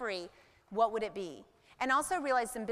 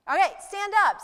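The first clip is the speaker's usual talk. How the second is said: mock stage command; 8 semitones higher, 9 dB louder than usual. Imitating Tony Robbins commanding the crowd.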